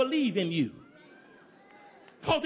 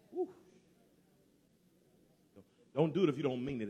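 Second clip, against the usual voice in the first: normal talking voice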